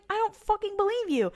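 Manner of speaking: high-pitched incredulous protest; mock disbelief. Dad to intruders claiming to help, mock incredulous. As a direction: voice a dad who won't buy it.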